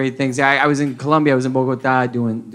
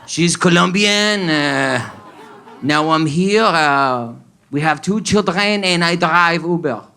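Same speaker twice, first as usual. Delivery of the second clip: hammed-up lovelorn Frenchman; drawn-out French accent across Spanish and English; slower than usual. French driver explaining his Colombian love, mock French accent. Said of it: "And he was like".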